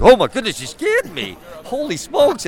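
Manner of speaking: high, hammed-up startle; mock fright. Batman humoring a kid's scary voice, mock-frightened. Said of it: "'cause he scared Batman".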